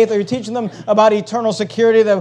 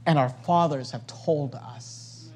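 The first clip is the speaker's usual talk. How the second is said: much softer and lower, slowed; soft tender quote; 7 semitones lower, 13 dB quieter, slower than usual. Acted. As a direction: hush it like a treasured memory. Loves the phrase.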